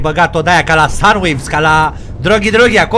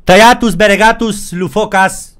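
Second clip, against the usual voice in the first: hammed-up fake Latin incantation; pompous mock spell words; 3 semitones higher than usual. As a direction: chant a curse. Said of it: "vrăjile astea".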